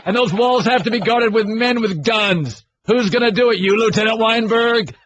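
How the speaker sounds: hammed-up courtroom speech, light on bass; Jack Nicholson lines. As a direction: declaim it like a boy playing the colonel. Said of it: "deliver the Jack Nicholson lines".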